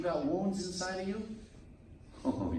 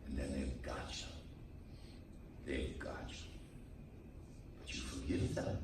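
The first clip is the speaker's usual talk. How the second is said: much softer and low, drawn in; quiet ominous aside, lower; hushed warning; 15 dB quieter than usual. Real, not acted. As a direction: pull the congregation in close. Preacher lowering for effect.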